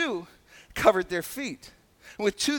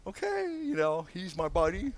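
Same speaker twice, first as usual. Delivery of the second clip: casual familiarity; 4 semitones lower, 7 dB quieter than usual. Acted. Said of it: "it's like".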